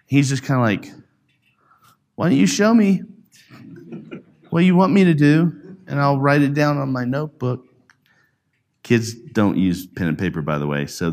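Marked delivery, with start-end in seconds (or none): none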